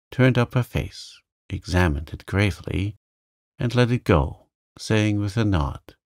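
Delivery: ordinary delivery, nothing marked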